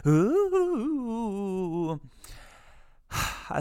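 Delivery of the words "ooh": long high ghostly "ooooh"; playful, drawn-out haunting howl; spooky ghost wail. Pretend. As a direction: wail like a cartoon ghost, sliding down. Spooky noise after haunted closet plug.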